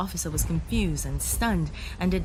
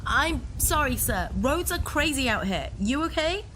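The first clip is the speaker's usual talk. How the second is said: higher, louder call over the storm; shouting over rain; 10 semitones higher, 4 dB louder than usual. Acted. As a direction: raise your voice above the downpour. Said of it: "I shouted over the rain".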